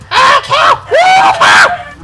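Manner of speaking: very high, loud whooping yell; excited shout. Real, not acted. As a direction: whoop at the cow like a wild hunter. Chasing cows in slaughter game.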